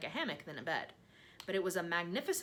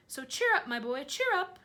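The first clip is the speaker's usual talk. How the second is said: high, louder jolly urging; hearty cheering up; 9 semitones higher, 8 dB louder than usual. A narrator's reading. Centipede jollies James along, high and loud.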